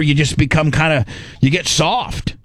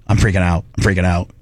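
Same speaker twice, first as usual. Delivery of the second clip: ordinary delivery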